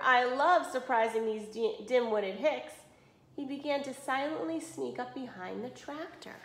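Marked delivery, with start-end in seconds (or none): none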